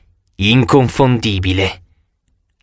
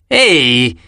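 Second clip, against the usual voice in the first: mild protesting call. A narrator's reading. Kid shoved aside by warriors, mild protest. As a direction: call out in objection, held back. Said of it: "Uno protestò".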